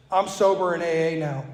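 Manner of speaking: normal talking voice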